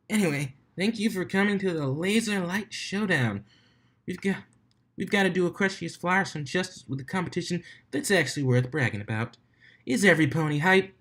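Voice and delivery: high, lively DJ emcee; hype announcer. Acted. DJ opening the Laser Light Showdown, excited. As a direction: rev up the stadium.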